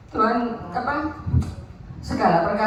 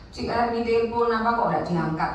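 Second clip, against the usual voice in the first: ordinary delivery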